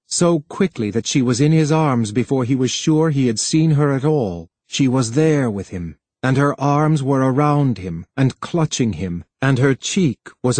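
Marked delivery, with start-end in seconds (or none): none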